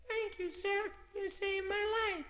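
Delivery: very high, small grateful voice; tiny snowflake. Acted.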